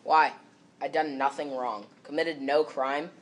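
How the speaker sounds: normal talking voice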